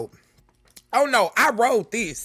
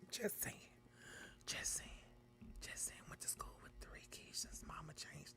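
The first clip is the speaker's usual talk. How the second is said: breathy whisper, almost no voice; whispered aside; 31 dB quieter than usual. Real, not acted. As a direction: slip it in as a secret whisper to the chat.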